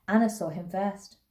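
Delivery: plain reading voice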